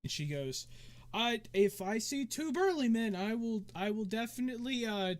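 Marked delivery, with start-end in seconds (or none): 1.1-5.1 lightly voiced dancer, a bit higher; a hint of a high-voiced hostess; obliging tavern dancer. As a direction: promise help in a slightly raised character voice. Game master plays the dancer.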